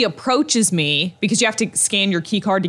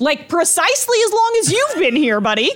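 sarcastic retort; 10 semitones higher than usual. Retold or imitated.

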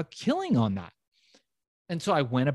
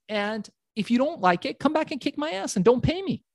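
cocky dare; 11 semitones higher than usual. Retold or imitated. Bold offer to dealer.

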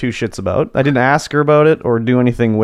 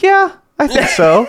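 a hint of a higher coworker voice; lightly mimicking coworker; 12 semitones higher than usual. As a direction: lightly play the gullible coworker. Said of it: "She's like".

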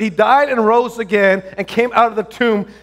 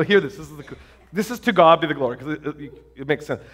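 ordinary talk, nothing marked

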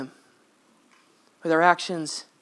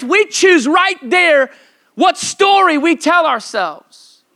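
slow, rising, emphatic preaching; preaching emphasis; 5 semitones higher, 4 dB louder, slower than usual. Acted. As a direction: drive each point home slowly.

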